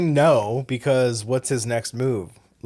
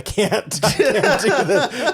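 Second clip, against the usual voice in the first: laughing; 10 semitones higher, 4 dB louder, much slower than usual. Retold or imitated. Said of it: "she's like".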